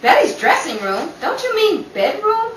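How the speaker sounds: high, loud, agitated exclaim; excited high outcry. Acted. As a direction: blurt it out in alarm. Rachel alarmed about the robbery.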